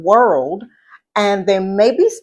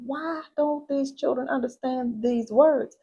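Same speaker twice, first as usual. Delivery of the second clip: soft, higher-pitched retelling of private bafflement; puzzled inner voice; 4 semitones higher, 11 dB quieter than usual. Retold or imitated. Teacher recalling thoughts about students who didn't understand, puzzled and hushed. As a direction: wonder to yourself, hushed and raised in pitch. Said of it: "And I'm like".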